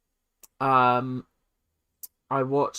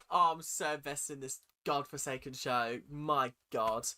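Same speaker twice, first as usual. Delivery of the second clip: ordinary delivery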